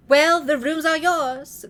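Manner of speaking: put-on slow, loud grand old lady; Grandmère voice. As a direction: play the haughty grandmother, slowly. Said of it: "Grandmère said".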